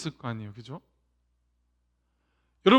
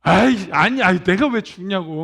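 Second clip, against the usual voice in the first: loud protesting denial; 6 dB louder than usual. Retold or imitated. Dying patient loudly denying his diagnosis. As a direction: insist loudly it's not true. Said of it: "부정을 한대요".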